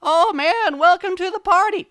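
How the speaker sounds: very high, loud squeaky host; faked high-pitched party-goer; put-on party voice. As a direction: shriek a welcome to the party. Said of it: "excellent at putting on different voices".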